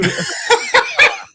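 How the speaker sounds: loud squealing laugh; real high-pitched laughter; high laugh burst. Real, not acted. Laughing hard.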